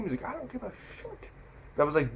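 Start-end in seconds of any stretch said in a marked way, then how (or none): 0.2-1.1 soft, high strained mock yell; mock angry driver. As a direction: imitate a driver yelling, kept quiet. Driver snaps back in retold quarrel.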